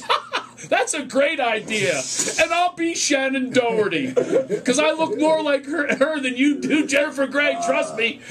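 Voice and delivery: high, laughing, slow teasing; laughing teasing friend. Retold or imitated. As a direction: tease her through laughter, up high. Friend jokes about lookalikes.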